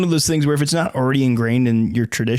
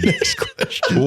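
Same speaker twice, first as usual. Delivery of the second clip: laughing slowly through it; much slower than usual. Real, not acted. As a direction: echo it while cracking up. Echoing, laughing.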